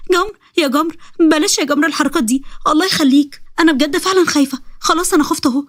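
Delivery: scared pleading. Acted. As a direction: beg him to stop, scared stiff. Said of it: "سارة فضلت تصوت".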